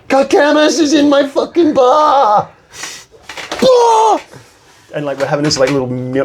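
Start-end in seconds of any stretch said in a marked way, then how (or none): 0.1-2.5 very high, louder, slow starstruck yell; excited shout. Retold or imitated. Retold starstruck freak out. 3.6-4.2 very high, loud "Bah!" burst; excited yelp. Retold or imitated. Retold freak out.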